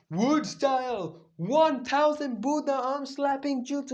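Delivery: high, slow, stretched technique call; Naruto's jutsu battle cry. Acted. Naruto unleashing wood style on Madara, high drawn-out cry. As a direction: proclaim the move way up high, drawn out.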